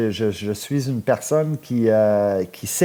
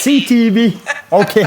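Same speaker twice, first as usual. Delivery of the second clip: amused surprise; 10 semitones higher, 7 dB louder than usual. Real, not acted. Host reacts to caller's slip.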